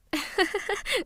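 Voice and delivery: high, light giggle; amused laugh. Acted. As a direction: laugh off a teasing accusation. Amused by spouse's suspicion.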